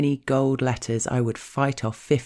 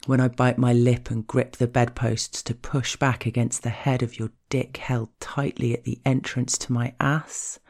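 normal talking voice

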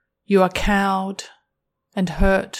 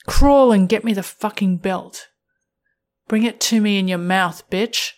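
commanding dominatrix; 8 dB louder, faster than usual. Acted. Dominant partner gives an order.

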